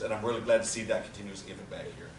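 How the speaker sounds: ordinary talk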